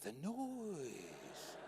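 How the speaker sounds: soft, long drawn-out mock agony; hushed, suffering moan; quiet, pained.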